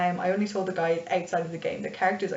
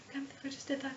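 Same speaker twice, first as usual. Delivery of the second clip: tiny, high, very soft voice; small shocked near-whisper, repeating anxiously; timid healer; 6 semitones higher, 14 dB quieter, faster than usual. Acted. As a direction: murmur in shock at yourself, high and hushed. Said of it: "that's the way she talks".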